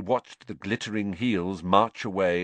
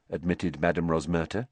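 ordinary delivery

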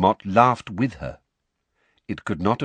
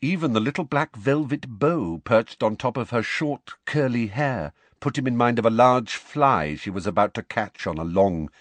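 plain reading voice

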